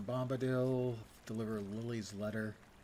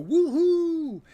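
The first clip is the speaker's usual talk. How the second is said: loud high whoop of triumph; excited whoop; 13 dB louder than usual. Retold or imitated. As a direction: cheer like a winner. Mock cheer of triumphant players.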